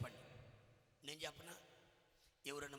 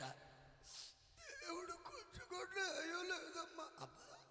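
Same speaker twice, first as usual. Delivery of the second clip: very high, slow, sobbing wail; mock wailing cry; much slower than usual. Preacher parodying women weeping at a funeral, slow high sobbing wail. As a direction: draw out కూడా in a mock tearful lament. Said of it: "వాళ్ళు ఏడిచేది ఏడుపు ఏడవక".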